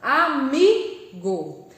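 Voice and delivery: Amigo very slowly, with MI strongest; stressed syllable. Shown as a demonstration. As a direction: lean hard on the MI in Amigo. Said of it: "a mais forte aqui dessa palavra".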